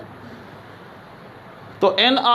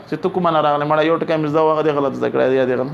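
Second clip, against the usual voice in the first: mild mock anger; 6 semitones lower, faster than usual. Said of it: "तो हो सकता है कि वो गुस्सा हो जाए".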